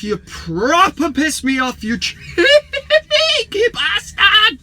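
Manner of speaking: rising from indignant rant into a long high screech; exasperated shriek. Real, not acted. Vlogger raging at viewer who wound him up, exasperated shriek. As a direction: stretch the insult into a shrill squeal.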